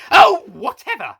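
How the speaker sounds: high, loud dismissive yell. A narrator's reading. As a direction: shout it as you storm out. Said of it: "Mary yelled".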